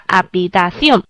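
"habitación" stressed on the final "ción"; stress on last syllable. Shown as a demonstration. Teacher models the singular, "habitación" with stress on "ción". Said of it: "那我们重读的时候是ción".

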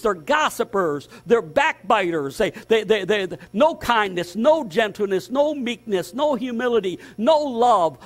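fiery preaching. Acted. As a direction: preach it hard, build the list. Preaching at full force.

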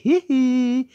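very high slow chuckle; cooing chuckle. Acted. Teacher chuckling fondly at the boy, very high. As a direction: chuckle over the little boy.

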